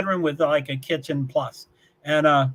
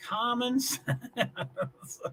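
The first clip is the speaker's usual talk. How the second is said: slow, softer, breaking into laughter; laughing; 5 semitones higher, 9 dB quieter, much slower than usual. Real, not acted. Host repeating a chat phrase, laughing. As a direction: draw it out and crack up.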